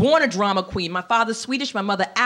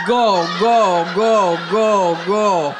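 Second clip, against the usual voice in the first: lower, robotic repeated pulse; imitated traffic light; 3 semitones lower, 4 dB louder, much slower than usual. Blinking eyes like traffic lights, imitated signal voice. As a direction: pulse each word evenly like a blinking signal. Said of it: "looks like traffic lights, saying".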